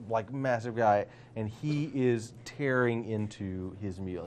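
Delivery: ordinary delivery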